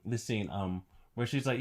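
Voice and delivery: usual speaking voice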